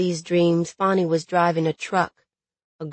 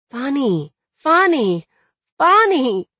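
name repeated, rising higher and louder; high, pleading interruption; urgent calling; 7 semitones higher, 5 dB louder than usual. A narrator's reading. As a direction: call his name to break through, more insistent each time. Trying to calm her yelling lover.

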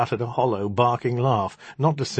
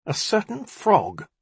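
accusing magician; 10 semitones higher, slower than usual. A narrator's reading.

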